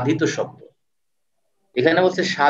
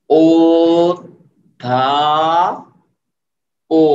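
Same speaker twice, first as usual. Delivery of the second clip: drawn-out syllables ও, ধা, ও one at a time; slow syllable by syllable; 5 dB louder, much slower than usual. Shown as a demonstration. Teacher sounding out word for class, slowly by syllables. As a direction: split অধ্যাপক into slow separate beats for students. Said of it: "অধ্যাপক এভাবে না".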